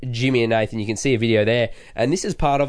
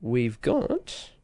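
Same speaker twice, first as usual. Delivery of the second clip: drawn-out boast; 12 semitones higher, much slower than usual. Real, not acted. Proud boast about follower count.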